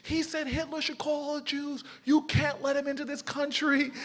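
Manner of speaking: very high mock scream; high, wailing impression of an outraged complaint; retold screaming. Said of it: "starts screaming at the passport control officers".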